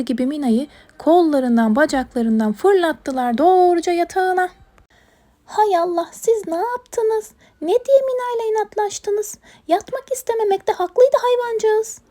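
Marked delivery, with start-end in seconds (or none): 5.4-12.0 dismayed scolding. Acted. Owner scolds animals over Mina's bedtime, high dismay. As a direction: reproach them in a raised, fretting voice. Leyla reproaches animals for forcing Mina.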